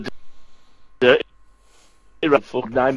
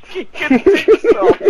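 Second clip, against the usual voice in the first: much higher, slow, squeaky disbelief; incredulous echo; 4 dB louder, much slower than usual. Real, not acted. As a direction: repeat his words back in shocked disbelief. Incredulous repeat of friend's line.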